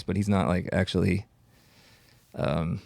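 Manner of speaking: ordinary talk, nothing marked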